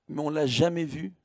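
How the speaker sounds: ordinary talk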